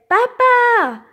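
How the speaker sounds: high, loud call for dad; little girl calls papa from her bed, loud and high. A narrator's reading. Child calling her dad from her bed, high and loud.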